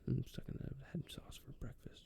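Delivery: breathy mock-secret whisper; whispered thoughts. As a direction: murmur it as if thinking aloud.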